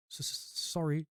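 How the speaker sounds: a touch of a sob, stammering the S; sobbed stammer. A narrator's reading. A touch of a sob as Hagrid apologizes. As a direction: Hagrid sniffling through it. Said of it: "sobbed Hagrid".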